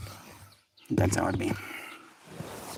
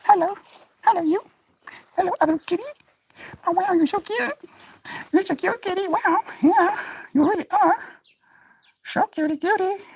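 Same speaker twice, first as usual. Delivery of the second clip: much higher cooing pet voice. Real, not acted. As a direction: coo adoringly at the kitty. Cooing at other kitty.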